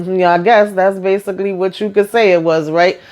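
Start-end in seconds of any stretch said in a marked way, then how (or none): none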